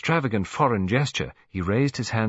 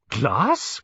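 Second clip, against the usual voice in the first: high, louder startled question; astonished cry; 5 semitones higher, 4 dB louder than usual. A narrator's reading. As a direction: cry out in disbelief. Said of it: "cried Missus Lacy, astonished".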